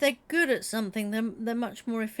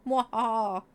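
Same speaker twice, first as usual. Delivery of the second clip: mad scientist; 4 dB louder than usual. Pretend. About to upset the game.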